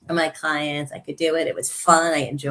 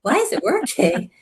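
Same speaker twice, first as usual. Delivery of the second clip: retold amazement; 9 semitones higher than usual. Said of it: "I'd be like".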